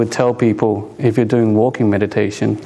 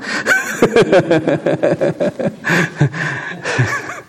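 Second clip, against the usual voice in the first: long, high-pitched chuckling; real laughter; 7 semitones higher than usual. Real, not acted. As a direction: laugh heartily at your own joke. Laughs at his own joke.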